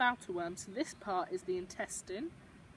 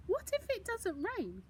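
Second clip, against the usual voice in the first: put-on child's voice; 9 semitones higher than usual. Child narrator asks Gran.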